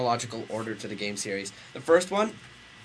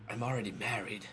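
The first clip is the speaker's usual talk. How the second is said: normal talking voice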